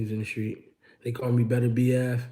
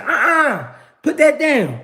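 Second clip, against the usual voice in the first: put-on much higher, louder old lady voice; scolding grandma; 14 semitones higher, 7 dB louder, slower than usual. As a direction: play a stern grandma stopping a fight.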